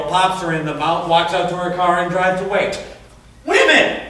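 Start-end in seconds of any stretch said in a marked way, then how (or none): 3.4-4.0 much higher, louder cry of disgust; exasperated exclamation. Acted. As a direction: throw up your hands and shout it. Exasperated punchline of his story.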